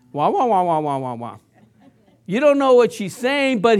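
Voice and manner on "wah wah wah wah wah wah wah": droning wah wah, falling lower; Charlie Brown's teacher. Retold or imitated. Pastor mimics the teacher's droning wah sounds to the congregation. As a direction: mimic the cartoon teacher trailing off. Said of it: "Charlie Brown when he's talking to his teacher".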